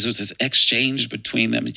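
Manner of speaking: ordinary delivery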